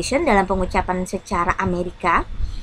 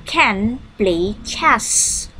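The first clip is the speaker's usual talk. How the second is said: can play chess said very slowly; drawn out slowly for learners; very slow and clear classroom model; slow model sentence; much slower than usual. Shown as a demonstration. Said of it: "Oke, she".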